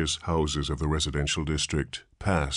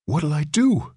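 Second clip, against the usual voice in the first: panicked; 11 semitones higher, 5 dB louder than usual. A narrator's reading. Panicked father asks nurse.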